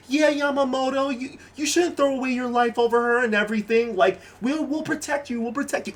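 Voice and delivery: a hint of a sweet classmate talking him round; schoolgirl voice. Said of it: "the girls in the class are like".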